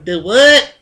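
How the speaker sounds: loud, rising southern drawl on the question; southern accent. Retold or imitated. Tired worker blurting a question, southern accent. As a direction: let the southern accent pop out. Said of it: "my southern accent just pops out".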